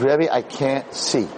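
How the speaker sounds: put-on slow, deliberate retelling of a frightened boy; slow pleading quote. Rabbi repeating the boy's cry to his listeners, slowed right down. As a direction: let each word land slowly.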